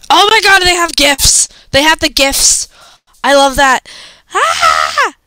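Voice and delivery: high, loud burst of delight; delighted squeal. Real, not acted. Fan discovering gifs in the quiz, loud high delight.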